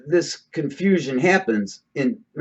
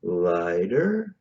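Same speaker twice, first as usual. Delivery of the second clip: voice drops on deeper; 8 semitones lower, 3 dB quieter, much slower than usual. Shown as a demonstration. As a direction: voice sinks low and soft on each deeper, lifts on lighter. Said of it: "you hear how my voice is starting to change every time I start to move toward deeper".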